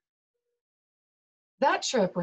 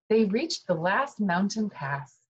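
plain reading voice

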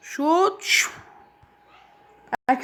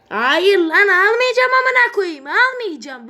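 furious shout; 6 semitones higher, 8 dB louder, slower than usual. Real, not acted. Gamer refusing in frustration.

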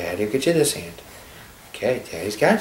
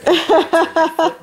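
put-on much higher, laughing baby voice; 7 dB louder than usual. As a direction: play the proud baby. Voicing baby, high laughing.

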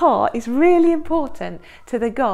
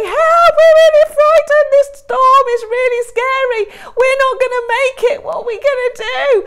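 much higher and louder, frightened cries; panicked friends; 13 semitones higher, 10 dB louder than usual. Acted. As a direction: cry out for help in a storm.

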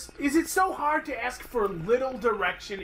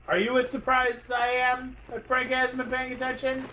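mock announcement; 5 semitones higher than usual. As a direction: announce it to the room with mock surprise. Mocking announcement to everyone.

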